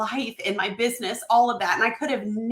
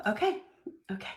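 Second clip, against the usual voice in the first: usual speaking voice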